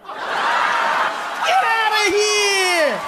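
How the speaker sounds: high, drawn-out delighted yell; mock shout. Comic marvelling at the president's smooth comeback. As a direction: stretch it out in shocked delight.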